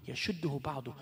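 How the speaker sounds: soft unvoiced whisper. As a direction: breathe the words in a whisper. Whispered verse fragment.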